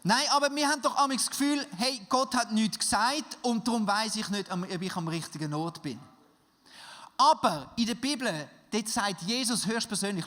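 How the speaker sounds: laut und hoch, eindringlich predigen; Predigt mit voller Kraft. Acted. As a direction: mit Feuer zur Gemeinde predigen. Preaches again at full force. (laut predigend, der Prediger ruft die Gemeinde auf)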